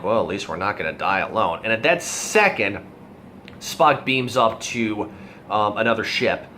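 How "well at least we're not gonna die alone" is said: low, quick, understated one-liner; McCoy. Retold or imitated. McCoy to Spock, surrounded by enemy ships, in a low quick mutter. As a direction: toss it off low and fast. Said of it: "McCoy lets off this one line like".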